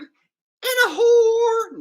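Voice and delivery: squealed and drawn out, loud and very high; high shouted punchline. Pretend. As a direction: shriek the last word in the baby voice. Joke punchline in dog voice.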